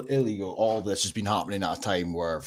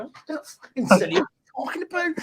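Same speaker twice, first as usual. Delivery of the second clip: high, incredulous mock voice, pitched way up; mocking retort. Mocking an imagined reply in a high incredulous voice. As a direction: squeak it out as a scoffing caricature.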